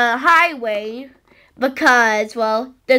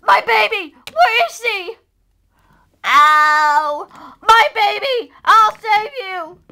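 high, panicked pretend calling; frantic parent; 6 semitones higher than usual. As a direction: cry out for a lost baby. Pretend parent seeking lost baby.

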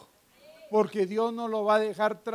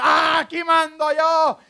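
high, loud mock bellow; imitated domineering shout; 7 semitones higher, 8 dB louder, slower than usual. Man storming into his house to take charge, acted shout. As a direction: burst in shouting like the boss of the house. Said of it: "aquel que entró gritando a su casa".

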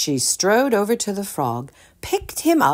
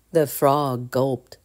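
ordinary delivery

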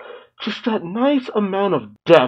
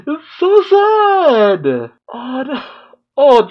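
high crying wail; 11 semitones higher, 4 dB louder, slower than usual. Real, not acted. Watching a sad episode, a high tearful wail. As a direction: voice cracks high and loud into a wail.

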